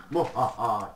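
loud mock evil laugh. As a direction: cackle like a cartoon villain.

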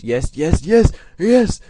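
high, loud victory cheer slowing down; triumphant gloating. Real, not acted. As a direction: crow over the win. Gloating over winning.

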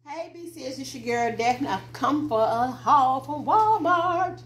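usual speaking voice

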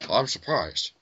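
ordinary talk, nothing marked

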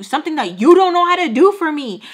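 loud, high angry retort to mom. Retold or imitated. Daughter arguing angrily with her mom. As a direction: throw it back at her, furious. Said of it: "I was like".

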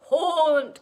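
loud, high daddy ghost cry; long ghostly call; cried out. A narrator's reading. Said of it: "cried the daddy".

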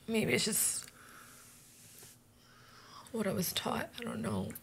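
ashamed whisper. Acted. Ashamed confession.

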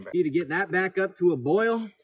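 normal talking voice

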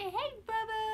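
put-on high little baby voice. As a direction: speak for the baby, sweetly.

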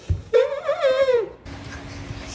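long high giggle; high laugh. Real, not acted. Laughs as he must jump.

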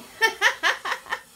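high-pitched giggle. Real, not acted. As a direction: burst of high laughter. Laughs at her globby painting.

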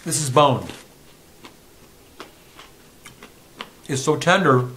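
talking while chewing; words round a crunchy bite; mouth full. Real, not acted. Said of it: "You can hear me crunching it".

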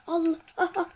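excited yelps. Real, not acted. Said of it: "Neden bağırıyorum ben?".

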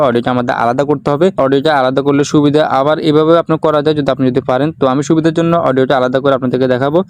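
usual speaking voice

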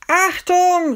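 high, loud cry of warning; sudden high-pitched alarm call; warning shout. Acted. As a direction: yell to stop someone getting hit. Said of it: "rief ich".

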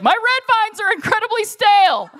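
very high-pitched wail of complaint; mock-hysterical screech; hammed-up distress. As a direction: wail it like a dire emergency. Retold distraught candy customer.

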